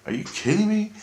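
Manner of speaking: very high, incredulous question; retold disbelief. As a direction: act out disbelief, pitch soaring.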